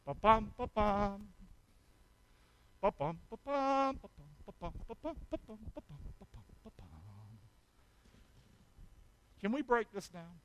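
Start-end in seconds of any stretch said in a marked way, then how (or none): 0.0-6.9 heartbeat imitation.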